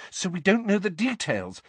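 high, anxious follow-up; uneasy, placating girl; nervous. A narrator's reading. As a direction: finish the excuse nervously in a high voice. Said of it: "said Hermione nervously".

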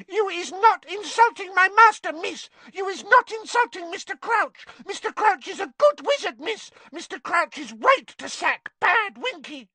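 very high, loud outraged elf; shrieking little creature, furious; screeching. A narrator's reading. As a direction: screech it in defence of your master. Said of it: "and screeched".

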